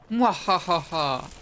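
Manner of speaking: slow, loud villain cackle; mad scientist. Pretend. Gleeful while collecting bottles.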